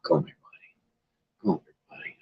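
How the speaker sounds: whispered coaxing; whispering to the cat. Real, not acted. Streamer whispering to his shy cat, whispered coaxing. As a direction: softly whisper the cat over.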